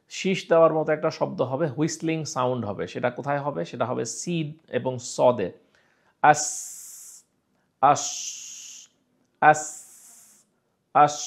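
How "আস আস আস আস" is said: আস with a sharp whistling hiss on the s; whistled s sound. Shown as a demonstration. Teacher demonstrating a whistling sin, hissing sharply. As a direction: let আস end in a sharp whistle. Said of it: "এইখানে একটা শীষ বের হচ্ছে".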